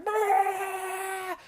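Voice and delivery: high shouted cry, imitating a failed scream; strangled scream. Describing sleep paralysis, a high shouted cry. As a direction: shout it high as if the throat is locked. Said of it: "I couldn't fucking scream my lungs out".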